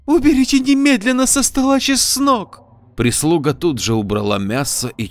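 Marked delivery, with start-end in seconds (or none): 0.1-2.6 much higher, loud alarmed scream; shrill shout. Acted. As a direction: scream the order in panic. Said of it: "громко крикнула". (пронзительно крикнув)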